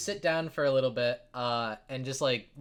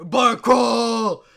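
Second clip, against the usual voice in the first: high, booming party shout; rowdy cheer; 9 semitones higher, 15 dB louder than usual. Acted. Dwarves headbutt each other celebrating, rowdy shout. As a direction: bellow it like a drunken war cry.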